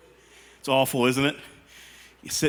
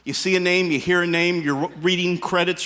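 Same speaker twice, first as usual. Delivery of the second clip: ordinary talk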